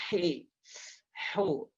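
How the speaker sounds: "Hi, hu" with long breathy throat onsets; spicy-mouth H. Shown as a demonstration. Teacher drilling the letter, airy throat H in "Hi, hu". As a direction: push air through the throat before each vowel of "Hi, hu". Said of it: "untuk melatih huruf H".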